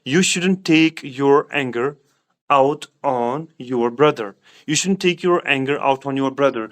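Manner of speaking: very slow, word by word model sentence; teacher modelling a sentence slowly; slow teaching demo. Shown as a demonstration. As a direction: lay each word out for the class. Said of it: "मैं इस तरह बोलूँगा".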